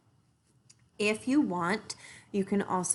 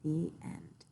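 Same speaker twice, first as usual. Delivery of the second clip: softer, slowing close; soft story ending; 3 semitones lower, 11 dB quieter than usual. A narrator's reading. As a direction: let the tale settle gently. Story closing.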